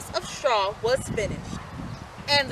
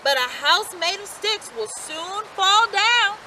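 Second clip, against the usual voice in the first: higher, slow woodcutter warning; 7 semitones higher than usual. A narrator's reading. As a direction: warn him patiently. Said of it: "answered the woodcutter".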